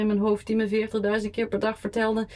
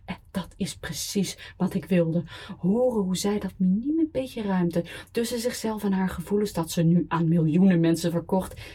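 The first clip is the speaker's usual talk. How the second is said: plain reading voice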